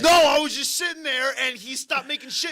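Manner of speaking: high, shouted self-defence; loud protest. Real, not acted. Comic denying he spied on the man, loud protest.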